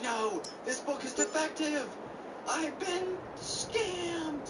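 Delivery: high, drawn-out indignant wail; hammed-up cry of being cheated; mock outrage. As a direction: protest dramatically, pitch soaring, last words stretched. Acted outrage over failed book.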